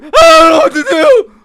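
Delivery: shouted, very high voice breaking into a laugh; hammed-up screaming footballer who can't cope; mock Messi panic. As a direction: shriek like a panicking striker, then gabble fast. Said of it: "And Messi's like".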